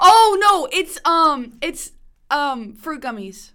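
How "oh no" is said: surprised groan. Real, not acted. Disgusted at tasting the gummy.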